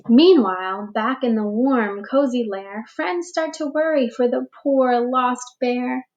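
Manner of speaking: put-on slow, expressive read-aloud for young children; storybook reading. A narrator's reading. Teacher reading a picture book to the class, gentle storytelling. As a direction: read it like story time for little ones.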